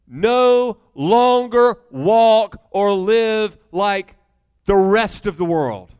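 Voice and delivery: loud, very slow, word by word; forceful, measured preacher's charge; emphatic command. Acted. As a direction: hammer each word as a separate beat. Preacher hammers the quoted command.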